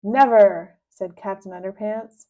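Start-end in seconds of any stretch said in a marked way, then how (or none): 0.0-0.8 defiant. Acted. Captain refuses defiantly.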